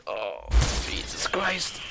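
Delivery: a stunned, voiceless Jesus Christ; breathless whisper. Real, not acted. Streamer to chat, after dying, whispering.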